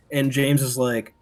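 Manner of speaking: normal talking voice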